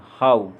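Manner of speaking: हाउ with a full आउ glide; OW diphthong. Shown as a demonstration. Teacher showing OW said as आउ, full glide in हाउ. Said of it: "आउ की तरह".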